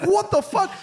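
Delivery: a high, loud what the fuck; shocked outburst. Retold or imitated. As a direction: blurt it out in total shock. Sees greenhouse flying.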